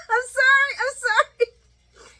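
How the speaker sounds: squealing high through real laughter; can't stop laughing while apologizing; laughing apology. Real, not acted. Cracking up at own joke.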